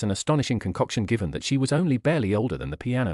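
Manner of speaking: ordinary delivery, nothing marked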